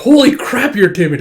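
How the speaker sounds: high, loud burst of disbelief; exasperated amazement. Acted. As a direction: exclaim at someone so timid. Amazed at his timidity.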